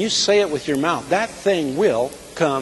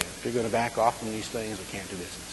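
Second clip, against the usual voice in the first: God speaking softly; 4 semitones lower, 8 dB quieter, faster than usual. Retold or imitated. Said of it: "is basically what God was saying".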